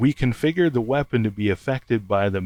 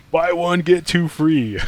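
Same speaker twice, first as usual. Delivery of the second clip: infomercial voice; 7 semitones higher, 4 dB louder than usual. Pretend. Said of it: "Sounds like an infomercial".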